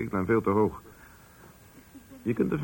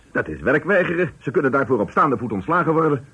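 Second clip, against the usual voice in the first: louder, higher outrage; indignant; 4 semitones higher, 7 dB louder than usual. Acted. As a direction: bristle at the workers' refusal. Boss outraged at refusing workers.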